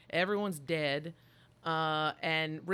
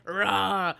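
monster roar; 2 semitones higher, 12 dB louder than usual. Retold or imitated. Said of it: "they would be like".

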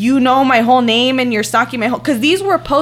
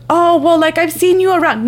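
higher, mocking retelling of a clueless person; mock dumb; 5 semitones higher than usual. Retelling the neighbor's claim of having seen her around, mock dumb. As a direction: imitate the neighbor as dim, pitched up. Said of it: "she was all dumb".

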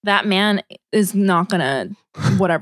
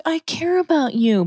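higher-pitched mimicked concern; put-on caring voice, pitched up; 7 semitones higher than usual. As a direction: imitate someone sounding like they care. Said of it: "her tone was like".

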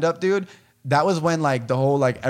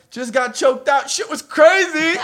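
high, hyped-up storytelling voice; excited; 12 semitones higher, 3 dB louder, slower than usual. Retold or imitated. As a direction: sell it like big news. Quoting his own Snapchat post.

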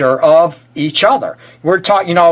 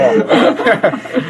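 higher-pitched laugh; 6 semitones higher than usual. Real, not acted. Host amused by the guests, laughing. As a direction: break into a high laugh.

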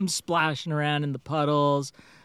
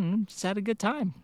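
ordinary talk

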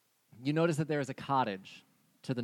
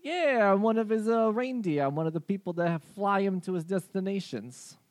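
higher, friendly reindeer voice; 8 semitones higher, 5 dB louder than usual. Acted. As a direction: play the chatty reindeer.

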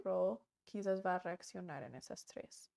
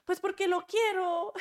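put-on high pouty child voice, much higher and louder; whiny little girl; 9 semitones higher, 8 dB louder than usual. Little girl demanding a cookie from her parent, high pouty voice. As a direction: play the stubborn kid who wants a cookie.

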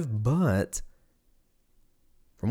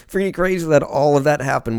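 higher, louder, still laughing through it; 4 semitones higher, 5 dB louder than usual. Real, not acted. Vlogger marvelling at history while laughing, voice raised. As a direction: say it while the laugh spills over.

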